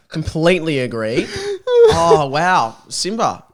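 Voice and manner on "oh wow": usual speaking voice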